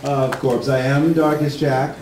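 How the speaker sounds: ordinary delivery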